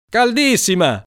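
put-on high, loud kid's shout; shouted boast. Mariassa yelling to his friends, high and loud. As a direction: yell it bravely though freezing. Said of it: "gridò".

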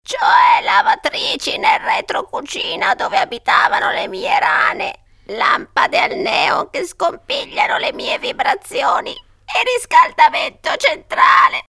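high, strained ghostly lament; moaning. A narrator's reading. Aunt listing the renovations to her family, moaning. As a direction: groan through each outrage, voice thin and high. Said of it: "gemette la testa di zia Ortensia".

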